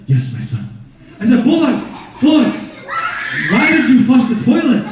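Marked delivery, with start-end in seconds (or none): 0.0-0.7 wise cat voice. Pretend. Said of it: "He's like". 1.4-4.8 laughing exasperated shout. Retold or imitated. Yelling at talking cat.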